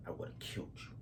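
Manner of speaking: soft hissed threat; threatening whisper. Retold or imitated. Angry man finishing his warning, soft threatening whisper.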